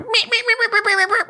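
very high squeaky bat meeps; bat squeaks. Acted. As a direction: squeak like a tiny bat through a laugh. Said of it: "whatever echolocation sounds like".

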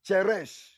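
"cheresh" said CHEH-resh with ch as in church; Hebrew pronunciation. Shown as a demonstration. Preacher naming Hebrew for darkness, careful pronunciation.

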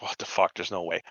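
stunned whisper; unvoiced shocked whisper; whispered disbelief. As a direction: breathe it out in disbelief, barely audible. Shocked aside about the actress.

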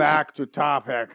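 loud, slow, firm reminder; firm call to order. Real, not acted. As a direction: pull everyone back on track, loud and slow. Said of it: "steering back, louder".

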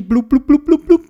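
quick high "blub" bubbles; imitated bubbles. A narrator's reading. Theobald finishing his underwater speech, high bubbling noises.

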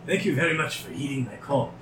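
a lightly voiced mad scientist; eccentric doctor voice. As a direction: lightly play the eager inventor. GM voices the Mind Flayer doctor.

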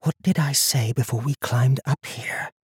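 hushed, breathy whisper close to the ear; secretive scolding whisper; very soft whisper. A narrator's reading. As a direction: whisper it as softly as you can. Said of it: "whispered as softly as she could".

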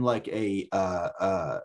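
ordinary talk, nothing marked

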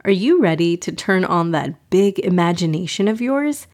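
usual speaking voice

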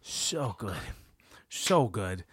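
low, hushed, savoring voice; softly relishing creep; creepy murmur. Retold or imitated. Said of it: "And he goes".

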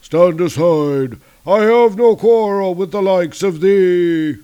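loud, high, strained monster proclamation; Mangog. Acted. Monster ordering the giants out of his path, loud roar. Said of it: "the Mangog approaches them. He's like".

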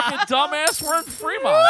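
high, loud mock-furious yell; exasperated yell. Son-in-law telling him they're in Fremont, exasperated yell. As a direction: hurl it like a retold phone rant. Retold caller yelling.

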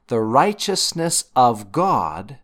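RIGHTEOUSNESS OF GOD slowed right down and hit hard; emphatic scripture reading. Shown as a demonstration. Teacher pointing listeners to the key phrase, stressed and slow. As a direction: read it deliberately, leaning on "righteousness of God". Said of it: "emphasize the word I wanna bring out".

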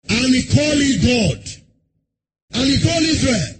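normal talking voice

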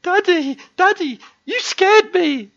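put-on high, drawn-out scared child voice; Leo. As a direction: imitate a frightened little boy whining to dad. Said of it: "He goes".

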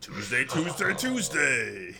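booming hyped-up arena promo; monster truck ad voice. Pretend. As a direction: shout it like a Sunday Sunday Sunday ad.

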